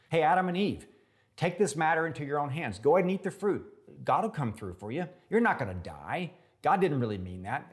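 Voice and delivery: high, loud coaxing tempter; devil egging on. Retold or imitated. Tempter urging Adam and Eve to eat, high and loud. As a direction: goad them into grabbing the fruit. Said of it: "he tempts us to live presumptuously".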